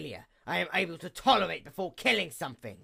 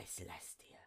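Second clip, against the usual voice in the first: whispered; 11 semitones lower, 24 dB quieter than usual. Acted. Whispered name of sister.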